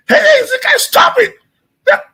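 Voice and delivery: high-pitched scolding shriek; mock scream at a child; imitated scream. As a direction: screech it like a fed-up mother, imitating her. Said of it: "look at him and scream".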